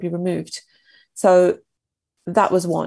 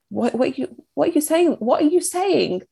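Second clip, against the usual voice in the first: higher-pitched, retold disbelief; incredulous husband; 8 semitones higher than usual. Husband answering his wife's apology for the miscarriage, incredulous. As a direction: imitate a baffled husband, pitch climbing on the repeats.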